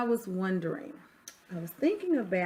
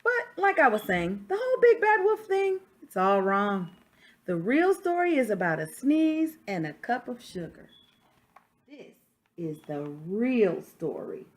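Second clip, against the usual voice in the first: lively read-aloud as the wolf, a touch louder; story narration; 2 semitones higher, 6 dB louder than usual. Acted. Reading a picture book to children, storyteller voice. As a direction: tell it like the wolf defending himself.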